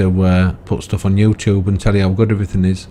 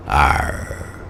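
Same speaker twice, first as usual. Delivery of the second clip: low, breathy pirate "arr" growl; 6 semitones lower, 3 dB quieter than usual. Pretend. Checking whether the voice was pirate or farmer, low pirate growl. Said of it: "No, that's pirate".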